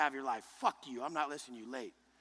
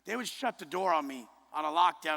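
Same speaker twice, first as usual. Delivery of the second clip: ordinary talk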